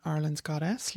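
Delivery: ordinary talk, nothing marked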